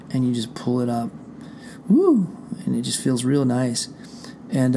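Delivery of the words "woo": 1 excited woo. Real, not acted. Feeling chicken flesh, exclaims.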